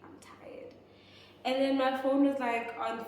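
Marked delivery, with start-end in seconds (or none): 0.0-0.7 whispered "I'm tired"; whiny whisper. Retold or imitated. Retold complaint, whispered.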